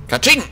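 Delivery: high, loud "Katsching" crash; a high, loud mock glass-shattering sound; glass smash noise. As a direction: make the window shatter with your voice. Breaking the window glass.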